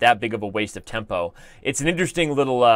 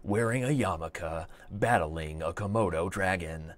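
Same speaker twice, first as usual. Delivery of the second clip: movie trailer voice; 6 semitones lower, 9 dB quieter, slower than usual. Pretend. Mock hype trailer for chess match.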